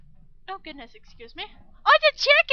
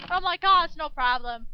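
ordinary talk, nothing marked

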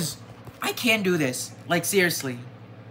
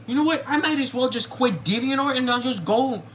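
higher-pitched, exasperated threat to quit; fed-up anger; 6 semitones higher than usual. Real, not acted. Artist threatening to quit DeviantArt, exasperated and higher. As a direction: sound done with it all. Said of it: "I am very angry right now".

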